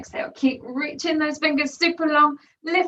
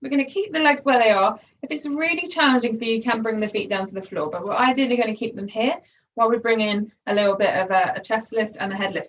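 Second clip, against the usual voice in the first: ordinary talk, nothing marked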